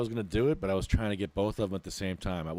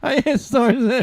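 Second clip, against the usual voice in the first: very high, loud exasperated wail; frustrated groaning; 13 dB louder than usual. Real, not acted. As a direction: howl it in disbelief. Frustrated, muted in the meeting.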